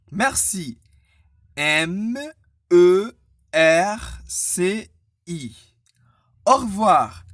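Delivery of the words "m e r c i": slow, loud, separated letters; spelled letter by letter. Shown as a demonstration. Teacher spelling merci for learners, letter by letter. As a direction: sound out each letter firmly. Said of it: "dire lettre par lettre".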